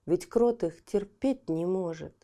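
plain reading voice

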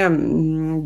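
usual speaking voice

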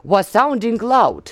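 slow, careful English with a Russian accent; Russian-accented quotation in English, word by word. Shown as a demonstration. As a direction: read out English deliberately, Russian accent showing. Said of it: "Простите моё произношение".

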